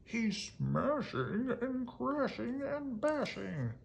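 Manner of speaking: high, slow, gleeful chant; cried. Acted. Gloom and Doom relish the monster's rampage, high and gleeful. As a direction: savour each word of the mayhem. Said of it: "cried Gloom and Doom".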